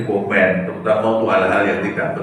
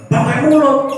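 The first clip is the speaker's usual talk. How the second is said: put-on higher, aggrieved young man; student's voice; 12 semitones higher, 4 dB louder than usual. Preacher voices his offended student answering him, higher and aggrieved.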